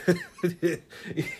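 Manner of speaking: bursting out laughing; can't hold back the laugh; real laugh. Real, not acted. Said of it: "And I'm like".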